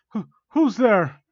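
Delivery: stammered, high, loud and slow; startled. Acted. Victim answers a mysterious voice, startled.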